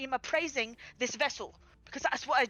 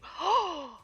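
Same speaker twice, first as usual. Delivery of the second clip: indignant gasp; 4 semitones higher than usual. Acted. Pirate to laughing companion who mocks the bum leg, indignant gasp. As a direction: gasp in theatrical disbelief. Mock outrage at being laughed at.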